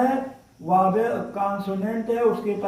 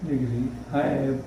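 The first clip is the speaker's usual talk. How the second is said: slow, lower, spelled-out dictation pace; 5 semitones lower, 9 dB quieter, much slower than usual. Shown as a demonstration. Teacher dictating the rule to students, slow and measured. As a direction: read it out slowly so students can write.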